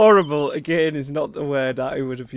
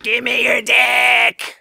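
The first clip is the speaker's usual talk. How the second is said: hammy shout; 7 semitones higher, 4 dB louder, slower than usual. Acted. Hammed-up lewd shout.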